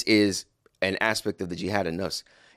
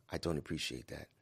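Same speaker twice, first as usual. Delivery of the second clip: much softer, low and calm; 5 semitones lower, 12 dB quieter than usual. Pretend. Husband calmly objecting to wife, very soft. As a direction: say it gently after a pause. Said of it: "with a calm tone after a pause".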